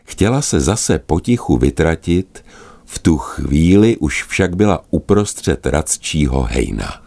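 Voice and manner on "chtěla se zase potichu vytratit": ordinary delivery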